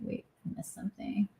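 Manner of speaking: unvoiced whisper to herself; whispered aside. Real, not acted. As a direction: whisper it, almost to yourself. Aside while reading a comment.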